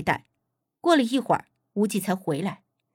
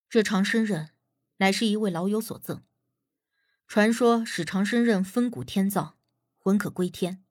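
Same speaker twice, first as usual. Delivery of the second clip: put-on quieter, lower-keyed storyteller of an old legend; solemn Wuji; 3 semitones lower, 4 dB quieter than usual. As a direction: explain the blade gravely and softly. Wuji solemnly explains the blade's legend.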